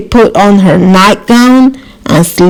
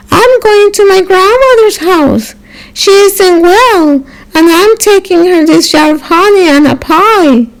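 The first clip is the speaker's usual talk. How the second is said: put-on high sweet little girl voice; a small girl chirping up at the wolf; 10 semitones higher than usual. Little girl answers the wolf, high and sweet.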